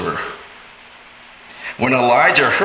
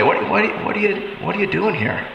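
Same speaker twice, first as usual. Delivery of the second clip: God asks the listener why they strayed, softly; soft, hurt question; 5 semitones higher, 5 dB quieter than usual. Acted. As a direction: drop to a softer, pained ask.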